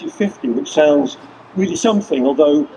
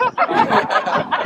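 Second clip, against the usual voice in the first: high real laugh. Real, not acted. Laughs at the bee petition.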